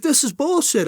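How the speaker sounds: mild mock yell at the defense table; retold yell. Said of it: "I'm yelling through the microphone".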